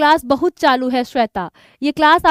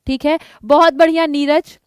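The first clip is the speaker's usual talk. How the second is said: usual speaking voice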